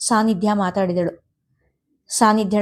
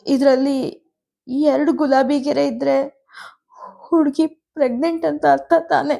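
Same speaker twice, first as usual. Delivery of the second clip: tearful mother; 5 semitones higher than usual. A narrator's reading. Mother tearfully reports daughter's pregnancy.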